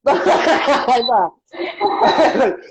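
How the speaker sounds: high laughing words. Real, not acted. Laughing at his own embarrassment.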